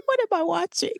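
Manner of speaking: can't believe what's on screen, laughing; laughing disbelief. Retold or imitated. Recalling yellow face in a Bette Davis film, laughing disbelief. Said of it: "and I was like".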